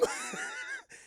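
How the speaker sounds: very high-pitched laughing fit; helpless squeal of laughter; high giggle. Real, not acted. Cracks up at impression.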